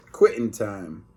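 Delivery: ordinary delivery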